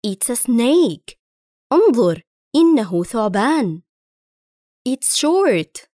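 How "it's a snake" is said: slow and clear, snake falling; slow model reading. Shown as a demonstration. Teacher reads a drill sentence to pupils, slow and clear. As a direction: spell it out slowly. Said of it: "Listen and repeat".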